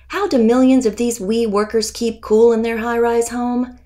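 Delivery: ordinary delivery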